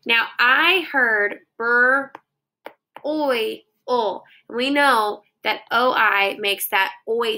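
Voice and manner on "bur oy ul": bur, oy, ul said slowly one by one; slow sounding out. Shown as a demonstration. Teacher reviewing the sounds she heard. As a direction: draw out each sound.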